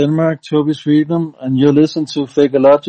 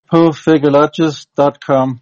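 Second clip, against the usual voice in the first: ordinary talk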